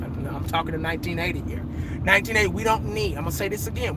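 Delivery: Jerry Jones hushing the room; imitating Jerry Jones. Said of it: "Jerry's talking".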